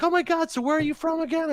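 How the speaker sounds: very high mock-fascinated voice; high-pitched imitation of an enthralled fan; awed listener. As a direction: imitate a gushing new acquaintance. Voices the fooled couple's question.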